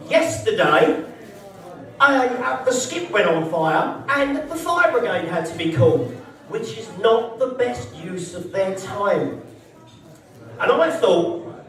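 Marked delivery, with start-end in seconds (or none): 0.1-9.4 slow, lower official read-out; mock office email. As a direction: spell out the formal notice with slow disapproval. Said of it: "an email come out from facilities".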